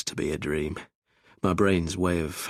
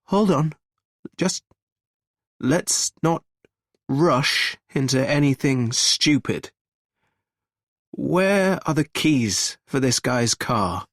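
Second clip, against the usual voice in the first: urgent caution; 8 semitones higher, 5 dB louder, slower than usual. Acted. Gregory urging caution.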